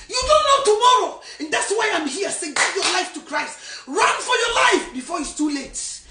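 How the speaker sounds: loud, rising urgent sermon cry; fiery preaching. Acted. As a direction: warn them with full force. Preaching at full force.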